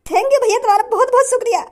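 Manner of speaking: high, weepy thanks; tearful gratitude. Acted. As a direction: thank your benefactor, overcome and near tears. Beggar thanks his benefactor again. (भावुक, रुआँसी आवाज़ में शुक्रिया)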